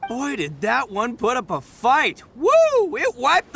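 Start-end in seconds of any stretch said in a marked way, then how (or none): 2.3-3.5 high, loud whoop. Acted. Man cheering the beetle that beat his own, loud and high. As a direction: let out a big whoop.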